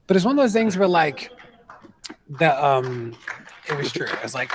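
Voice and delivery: ordinary talk, nothing marked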